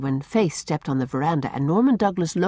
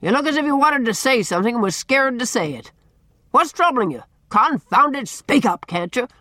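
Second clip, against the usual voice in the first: raised, impatient bullying voice; blustering demand, pitched up; booming; 5 semitones higher, 4 dB louder than usual. A narrator's reading. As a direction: boom on, higher and exasperated. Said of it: "he boomed".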